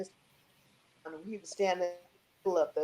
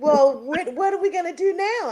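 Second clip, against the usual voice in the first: put-on high, louder childlike worry; a girl unsure what happens next; anxious little girl; 10 semitones higher, 8 dB louder than usual. Young self asks Basie, anxious.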